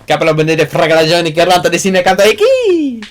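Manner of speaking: high, loud mock Italian gibberish; fake Italian. Showing off fake Italian to a friend, hammed-up. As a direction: rattle off made-up Italian with flair. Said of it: "that's the thing I made up".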